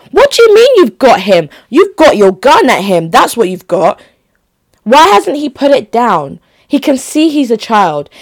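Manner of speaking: high, loud indignant outburst; real outrage, voice thrown up high; outraged. Real, not acted. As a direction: demand an answer, furious at the cop. Outraged at cop in video.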